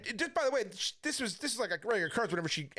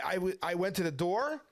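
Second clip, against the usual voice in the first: ordinary talk, nothing marked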